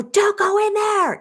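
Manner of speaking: a hammed-up high alarm voice; mock high warning. Shown as a demonstration. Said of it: "And it didn't go".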